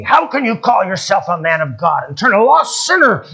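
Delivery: loud, high-pitched accusing yell; angry yelling. Retold or imitated. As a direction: imitate an outraged deacon yelling. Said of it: "began to yell at me".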